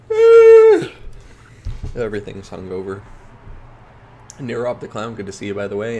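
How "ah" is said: loud groan. Real, not acted. Loud cry at slow game.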